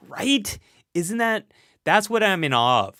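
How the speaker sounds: usual speaking voice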